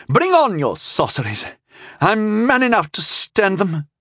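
high-pitched, defiant outburst; angered young knight. A narrator's reading. Young knight taunted by his opponent, angry defiance. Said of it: "That just angered the young knight".

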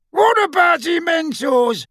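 high-pitched shouted suggestion; called out. A narrator's reading. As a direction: shout it across to the gang. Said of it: "called another Death Eater".

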